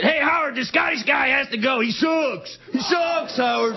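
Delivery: high, hammed-up angry fan; heckling radio caller. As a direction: imitate a jeering caller, pitched high. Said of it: "one of Howard Stern's fans called in".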